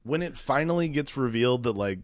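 normal talking voice

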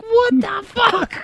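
half-laughing retold disbelief, stretched out; indignant outburst. Hearing another man claim his name, mock outrage. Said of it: "Like, I was so mad".

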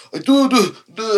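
high, stammering scared voice; mock scared stutter; scared Italian stammer. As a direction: stammer like a frightened man, pitched high. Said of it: "scared Italian dude going".

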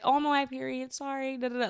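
high, singled-out mimicry of an excuse-maker; mock a schoolgirl tossing off an excuse; rude fake apology. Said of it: "being so rude".

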